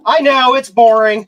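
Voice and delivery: high, very loud protest; loud exclaim. Real, not acted. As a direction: shout it out, exasperated. Reacting to boring stream joke.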